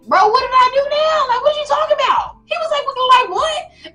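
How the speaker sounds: high, incredulous whine at his big brother; wronged kid asking what he did; indignant David. Retold or imitated. David protests to older brother Eliab, high and incredulous.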